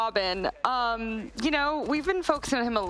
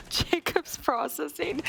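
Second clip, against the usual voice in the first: laughing; 4 semitones higher, slower than usual. Real, not acted. Laughing at the tall colleague.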